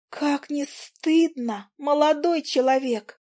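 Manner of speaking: high, slow, tiny scolding voice; a prim little fly lecturing the young man. Acted. The fly Zhuzha scolding Willy, high and slow. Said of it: "подключила Жужа".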